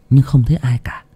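plain reading voice

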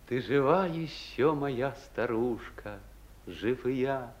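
soft, unhurried poem recital; reciting Yesenin softly. Acted. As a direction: recite it quietly, like a letter to your mother. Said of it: "А это Есенин".

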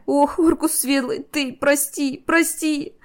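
high, slow, wailing apology; helpless high-pitched lament; tearful pleading. A narrator's reading. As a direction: beg forgiveness on the edge of tears. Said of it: "От бессилия заплакала Энира".

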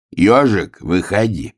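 plain reading voice

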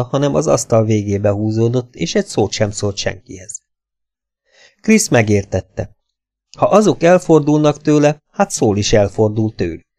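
plain reading voice

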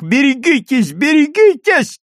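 high, loud cry of alarm; warning shout. Acted. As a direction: yell a frantic warning. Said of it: "раздался крик нескольких человек".

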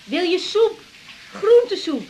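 high call to someone in another room; asking across the house about soup. Acted. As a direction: call it up the stairs, pitched high.

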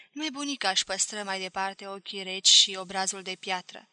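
ordinary delivery

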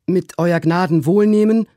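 servant Trim calling out. A narrator's reading. Servant begs his master's leave, high and deferential. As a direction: speak up respectfully, pitched high. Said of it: "rief Trim".

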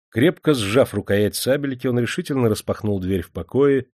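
ordinary delivery, nothing marked

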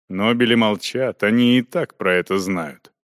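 ordinary delivery, nothing marked

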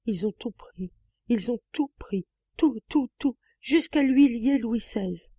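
put-on high, childlike stammering voice, slowing at the end. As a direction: babble it like a stunned child. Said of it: "balbutier d'une voix d'enfant". (balbutier d'une voix d'enfant)

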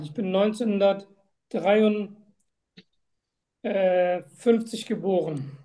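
usual speaking voice

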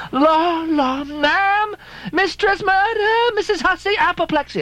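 very high, drawn-out alarmed cries; panicked maid shriek. Acted. Chambermaid shrieking for Mrs Hussey, very high panicked cries.

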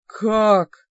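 booming wounded demon cry; demon roar. Acted. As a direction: roar it like a hurt beast.

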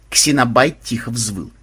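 ordinary delivery, nothing marked

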